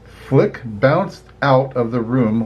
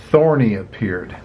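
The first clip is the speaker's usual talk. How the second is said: usual speaking voice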